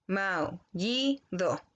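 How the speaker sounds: Maullido said slowly as mau-lli-do; dictating Maullido by syllables; split into syllables. Shown as a demonstration. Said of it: "todas las vocales que están juntas quedan en una misma sílaba".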